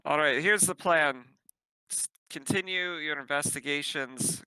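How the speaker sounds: usual speaking voice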